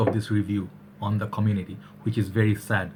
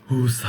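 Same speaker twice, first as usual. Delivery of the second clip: calming sigh. Real, not acted. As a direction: breathe out to tone down the hype. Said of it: "Tone down".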